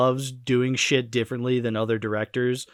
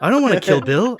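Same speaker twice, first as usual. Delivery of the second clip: high, louder mock movie-star voice; Mark Wahlberg; 6 semitones higher, 4 dB louder than usual. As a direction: protest like a whiny actor impression. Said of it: "Mark Wahlberg would have only".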